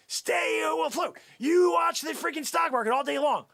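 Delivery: high, forceful shouted instruction; emphatic order. Acted. Salesman orders Joe Ellen to watch stocks, emphatic shout. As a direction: drive it home loudly.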